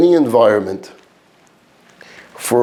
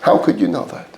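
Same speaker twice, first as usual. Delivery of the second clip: doubting listener; 4 semitones lower, 3 dB quieter than usual. Retold or imitated. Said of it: "Now you would ask me".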